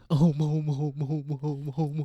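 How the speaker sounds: loud mumbling "mo mo mo". Acted. [name] mumble continuing.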